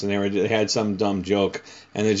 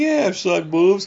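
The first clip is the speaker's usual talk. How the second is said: put-on higher, slow boastful kid voice; fake bravado; 10 semitones higher, 5 dB louder, slower than usual. Man replays his younger self bluffing to boys. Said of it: "I'm like".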